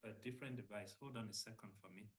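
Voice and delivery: faint, far from the mic; very low, distant voice; barely audible. Real, not acted. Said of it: "they said my voice is very low".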